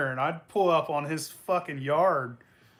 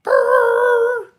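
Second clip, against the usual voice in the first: very high, loud tire-spinning roar; car peeling out; engine revving noise; 12 dB louder than usual. Retold or imitated. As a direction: rev it out like flooring the gas. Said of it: "it'd be like".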